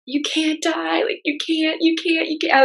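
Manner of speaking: high, pleading cry, only half performed; a mild echo of sobbing at him; retold sobbing plea. Wife recalls begging her sick husband, mild retold sobbing. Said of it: "just sobbing and being like".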